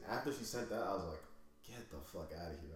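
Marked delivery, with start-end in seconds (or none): 1.7-2.8 low, soft muttered dismissal; muttered disbelief. Retold or imitated. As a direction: mutter it to yourself, retelling. Retold reaction to her text.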